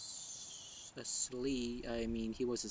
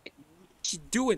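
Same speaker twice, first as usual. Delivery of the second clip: startled; 9 semitones higher, 12 dB louder than usual. Acted. Startled at woman in the fog.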